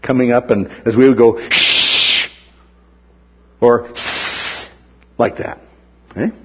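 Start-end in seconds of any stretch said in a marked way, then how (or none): 1.5-2.3 sharp shush; hushing hiss. Retold or imitated. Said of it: "hissing or hushing him". 3.9-4.7 quieter hushing hiss; soft shush. Retold or imitated. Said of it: "a hissing or a hushing sound".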